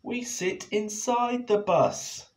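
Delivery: high, slow insistent voice; Penny tells Og how buses work; urgent explaining. Acted. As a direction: explain firmly. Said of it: "cried Penny".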